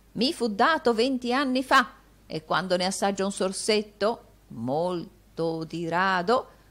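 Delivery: voce di vecchio un po' più alta; vecchio avaro compiaciuto. Acted. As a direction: pregusta il brindisi con gusto. Vecchio Gride assapora il brindisi. (vecchio che brinda, leggermente più alto)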